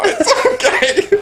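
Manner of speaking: high, slow, laughing through words. Real, not acted. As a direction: reassure her while cracking up. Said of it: "laughing reassurance".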